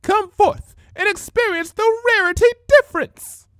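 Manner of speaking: very high, loud showman's proclamation; grand declamation. Acted. Changeling announcing herself to Ponyville, loud and grandiose. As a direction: sell it like a carnival barker to the crowd. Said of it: "she declaimed".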